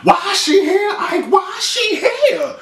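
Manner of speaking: imitating catty women spotting a rival; mock gossip girls. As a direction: drag out why she here like catty girls. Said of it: "all of a sudden they like".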